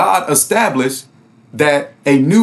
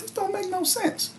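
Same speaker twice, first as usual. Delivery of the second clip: much higher, soft disbelieving reader; 14 semitones higher, 13 dB quieter than usual. Retold or imitated. Christians reading the Bible and doubting it, high and soft. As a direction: react like a believer finally seeing the flaw. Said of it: "they will say to themselves".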